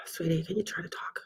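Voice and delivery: very soft, breath-led whisper; whispered coaxing. Real, not acted. Medium asking a spirit child to talk, whispered.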